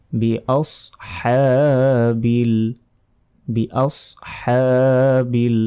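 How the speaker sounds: বি আসহাবিল said slowly with a strong হ; slow correct বি আসহাবিল; drawn-out pronunciation. Shown as a demonstration. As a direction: stretch বি আসহাবিল, pressing the হ. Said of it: "বড় হ্যাঁ, এটা উচ্চারণ করতে হবে".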